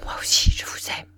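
rushed, breath-only whisper; hurried whispered confession; very quick stammer. A narrator's reading. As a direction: blurt it out fast in a whisper. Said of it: "elle balbutia très vite".

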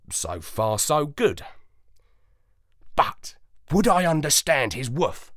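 usual speaking voice

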